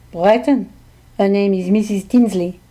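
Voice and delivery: put-on heavily French-accented English; thick French accent. A narrator's reading. Jocelyn answers where the psychic is, French accent. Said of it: "in her thick French accent".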